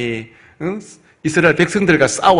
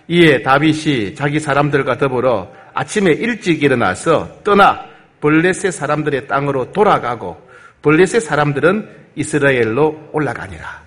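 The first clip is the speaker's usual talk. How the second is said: ordinary talk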